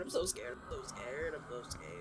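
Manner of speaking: low frightened murmur sinking to almost nothing; scared, fading away. Real, not acted. Player's fear chant trailing off, scared murmur. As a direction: let the frightened chant die away softly. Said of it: "I'm scared, I'm scared".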